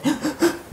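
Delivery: short, high sobbing gasps; imitated sobbing gasps. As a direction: mimic a woman's hiccupping sobs. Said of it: "gitu, sampai sesenggukan".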